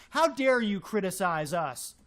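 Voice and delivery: normal talking voice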